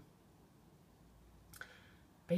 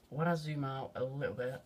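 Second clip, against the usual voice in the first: ordinary talk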